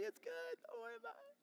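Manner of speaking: very high, soft, choked-up reassurance; tearful comfort. Retold or imitated. Joseph forgiving his weeping brothers, high and tearful. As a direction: forgive them through tears. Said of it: "and they're crying".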